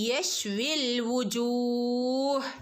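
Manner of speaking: slow chanted recitation; tajweed recitation. Shown as a demonstration. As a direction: recite യശ്വിൽ വുജൂഹ് slowly with half sukun. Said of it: "അപ്പൊ എങ്ങനെ ഓതും". (സാവധാനം ഓതുക)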